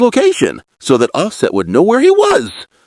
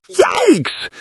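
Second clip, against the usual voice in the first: shocked yikes again. Acted. Vlogger repeating her reaction, high falling yikes. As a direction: echo the shock as a second punch. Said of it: "Emotional reaction".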